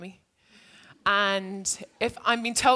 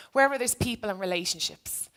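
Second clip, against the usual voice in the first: ordinary delivery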